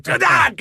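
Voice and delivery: sudden high yelp of fright; startled yelp. Retold or imitated. Retelling waking up to a toy up his nose, sudden high yelp. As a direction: yelp as a dinosaur head goes up your nose. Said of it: "and I would go".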